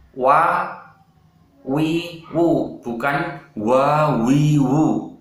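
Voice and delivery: slow wa, wi, wu without breath; clear wa wi wu drill. Shown as a demonstration. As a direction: drill wa wi wu slowly and crisply. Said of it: "ingat dibaca dengan wa". (latihan wa wi wu perlahan tanpa angin)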